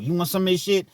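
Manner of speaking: high, loud mock inmate offer; hammed-up prison buddy offering food; prison talk imitation. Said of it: "That's how they talk".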